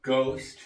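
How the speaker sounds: "Ghost" said with a hard G. Shown as a demonstration. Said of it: "sounds like a G".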